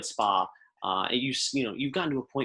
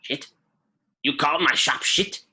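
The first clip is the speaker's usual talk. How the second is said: offended shopkeeper; 5 semitones higher, 5 dB louder than usual. Acted.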